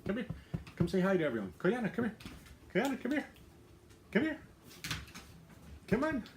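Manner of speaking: high, sing-song call to a dog; coaxing dog call. Real, not acted. Owner coaxing her shy dog to greet the viewers, high sing-song call.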